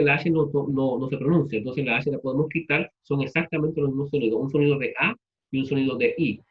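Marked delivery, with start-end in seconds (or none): none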